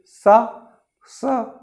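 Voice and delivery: सा said as a clear s hissed between the teeth; demonstrating the s sound. Shown as a demonstration. Teacher saying सा for students to copy. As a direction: say सा with air rubbing past the teeth. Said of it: "बोल के देखिए".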